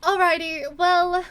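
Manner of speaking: put-on upbeat announcement to the kids; cheerful mom announcing good news; chipper mom. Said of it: "she claps her hands together".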